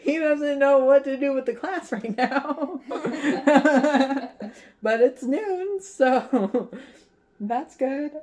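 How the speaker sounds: high, loud, giggly squeal; laughing. Real, not acted. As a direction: talk through a fit of laughter. Cracks up over Mister Holm.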